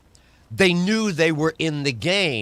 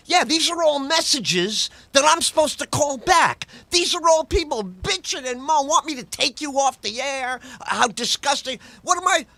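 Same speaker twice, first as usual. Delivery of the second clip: high mock boss; 10 semitones higher than usual. Boss griping about listener complaints, hammed-up high voice.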